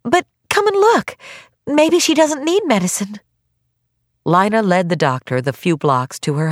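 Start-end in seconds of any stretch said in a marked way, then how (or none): 0.0-3.2 put-on high, insistent girl's voice; girl pleading hopefully; pleading young girl. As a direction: urge the doctor in a high young voice. Girl urges doctor to come.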